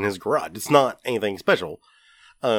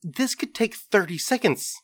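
higher, rising frustration at wasting half an hour; exasperated at himself; 4 semitones higher, 5 dB louder than usual. Retold or imitated. Retelling hunting for his socket set, exasperated. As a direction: vent the self-directed annoyance, half laughing. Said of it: "And I was like".

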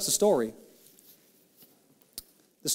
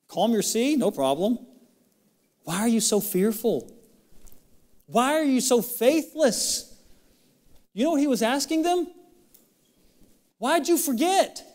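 rising pleading Jesus; 8 semitones higher than usual. Acted.

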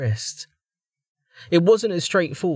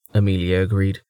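ordinary talk

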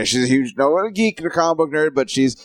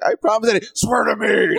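Batman voice; 9 semitones higher than usual. Retold or imitated.

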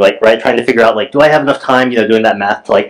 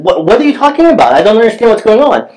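confused; 10 semitones higher than usual. Retold or imitated. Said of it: "and I asked, I said".

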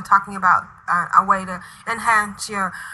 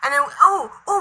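ordinary talk, nothing marked

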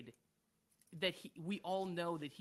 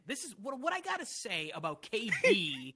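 brash, louder mock rant voice; mocking Rapaport; 6 dB louder than usual. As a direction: ham up a loudmouth pundit's rant. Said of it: "he'd be like".

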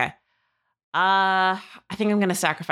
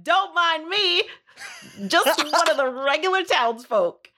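high, slow, sweetly innocent disguise voice; innocent townsfolk voice; 11 semitones higher than usual. Acted. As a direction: play the harmless townsperson. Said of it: "And I'm like".

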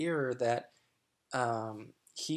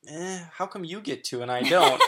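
half-laughing complaint, pitched up and louder; mock protest; 4 semitones higher, 6 dB louder than usual. Partner teasing her about unequal rules, mock protest. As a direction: play up a petulant objection, lightly. Acting out his own playful objection.

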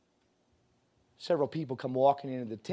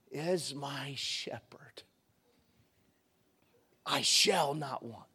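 whispered recitation; 3 semitones lower, 8 dB quieter, much slower than usual. Retold or imitated. Reciting Psalm twenty-three before cousin.

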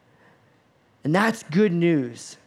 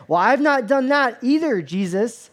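higher, louder protest of innocence; mock self-justification; 6 semitones higher, 4 dB louder than usual. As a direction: play a listener eagerly clearing himself. Imagined listeners boast to Jesus.

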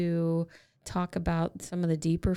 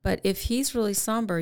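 ordinary talk

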